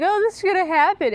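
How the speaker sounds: high, fast mock panic; mock panicked women. Mocking the show's fans, high fast mock panic. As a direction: ham up a panicky worrier. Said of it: "All the women in the world be like".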